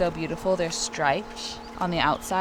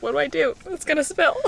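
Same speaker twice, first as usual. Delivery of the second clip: mock panic; 9 semitones higher, 3 dB louder than usual. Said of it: "I was like".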